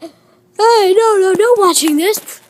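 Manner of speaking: loud, high play-acted protest; high shouted objection in play; indignant shooing. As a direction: yell at them to stop. Character shoos watchers from toilet.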